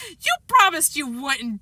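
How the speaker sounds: put-on drawn-out childish complaint; whining. A narrator's reading. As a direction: whine it like a wronged little kid. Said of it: "Daniel whined".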